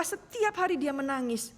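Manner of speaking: ordinary delivery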